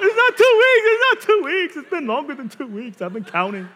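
very loud, high protest; hammed-up squeal of protest; defensive husband. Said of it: "And I was like".

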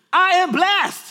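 put-on high, loud excited kid; child's proud shout. Children before school declare it to dad, loud high shout. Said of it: "they go like this".

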